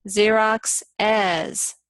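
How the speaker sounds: "Xerox as" said slowly, in three clear syllables; slow Xerox's. Shown as a demonstration. Teacher showing that the possessive adds a syllable. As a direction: spell out "Xerox as" as ZEE-rox-iz, slowly. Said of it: "three syllables".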